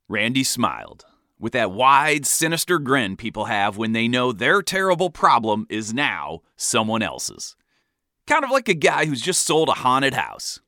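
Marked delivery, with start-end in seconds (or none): none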